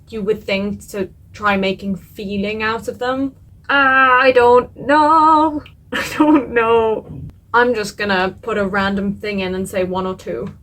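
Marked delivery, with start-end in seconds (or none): 3.7-5.6 very drawn-out, loud wail of indecision; anguished wail. Real, not acted. Torn between two words. 5.8-7.2 drawn-out half-laughing whimper. Real, not acted. Torn between two words.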